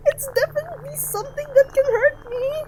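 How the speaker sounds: high, loud panicked cry. Real, not acted. Streamer panicking at the red text, high and loud. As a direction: voice jumps high in real panic.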